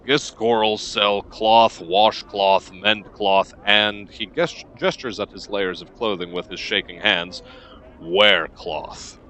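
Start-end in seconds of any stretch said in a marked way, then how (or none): 0.1-4.1 slow list ticking like a clock; flat, measured merchant. Acted. Cloth merchant lists his services to a customer, flat and measured. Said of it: "voice ticks like a clock, flat and measured". 8.0-9.2 low, evenly spaced words; flat, measured merchant. Acted. Cloth merchant shows off his robes, flat and measured. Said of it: "voice ticks like a clock, flat and measured".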